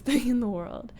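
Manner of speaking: usual speaking voice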